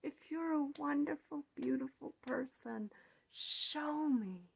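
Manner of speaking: indignant imagined retort; defiant challenge. Retold or imitated. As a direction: dare them to prove you wrong. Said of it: "I'm thinking to myself".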